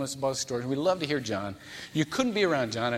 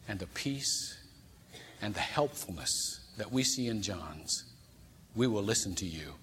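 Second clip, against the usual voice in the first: very calm voice; 5 semitones lower, 8 dB quieter than usual. Retold or imitated. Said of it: "in a very calm voice".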